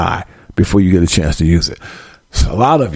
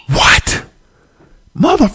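high, cut-off angry burst; explosive outrage; slower than usual. Acted. Dad exploding at child's threat, high outraged burst. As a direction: explode in disbelief, then start a curse.

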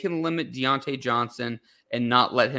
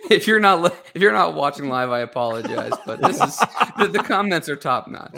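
talking through real laughter, voice jumping higher; helpless giggles breaking into the words; cracking up; 4 semitones higher, 6 dB louder than usual. Real, not acted. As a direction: apologize while still laughing. Cracking up at live comments.

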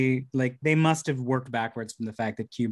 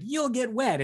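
mock ride warning; 10 semitones higher, slower than usual. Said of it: "it's like".

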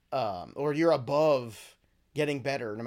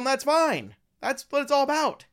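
emphatic reassurance; 11 semitones higher, 5 dB louder than usual. Real, not acted. Host reassuring fans, raised voice.

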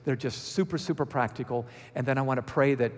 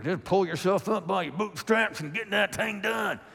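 put-on Georgia accent; 5 semitones higher than usual. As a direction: say it like a proud Georgian getting it done. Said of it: "You see my Georgia accent there?".

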